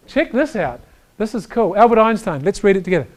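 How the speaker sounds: ordinary talk, nothing marked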